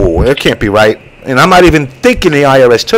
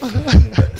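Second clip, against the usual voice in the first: short laugh; 10 semitones higher, 5 dB quieter than usual. Real, not acted. Laughs at goons remark.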